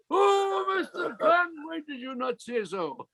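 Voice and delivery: the clerk lights up in surprise; sudden recognition. Retold or imitated.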